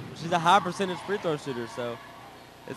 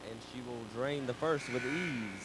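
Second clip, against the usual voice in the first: normal talking voice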